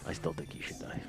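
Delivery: soft unvoiced joke; whispered teasing jab; whispered aside. Real, not acted. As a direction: breathe it under your breath.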